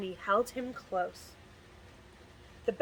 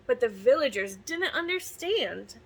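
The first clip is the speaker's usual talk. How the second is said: ordinary delivery, nothing marked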